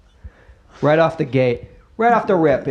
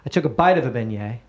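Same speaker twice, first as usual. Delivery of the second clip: ordinary talk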